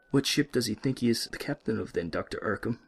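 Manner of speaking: put-on sly French-accented question; the nurse teasing the patient through his father; taunting French nurse.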